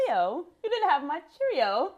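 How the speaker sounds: high, hammed-up British accent; badly faked British, rising at the end. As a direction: try a chirpy mock British accent. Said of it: "Okay, do Cheerios".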